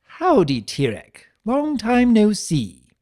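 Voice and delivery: loud, high, slowed showman hello; cheery trickster greeting. Acted. Chaos spirit gleefully greeting his betrayer, loud and high. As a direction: greet him like a long-lost pal, overdone. Discord greets old foe.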